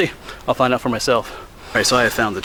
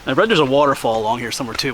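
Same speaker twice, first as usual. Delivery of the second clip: ordinary delivery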